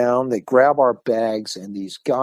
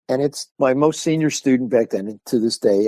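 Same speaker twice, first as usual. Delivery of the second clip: usual speaking voice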